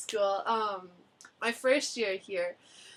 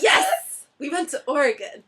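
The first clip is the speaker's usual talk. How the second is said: put-on eager kids; 4 semitones higher, 9 dB louder than usual. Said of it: "kids insisting".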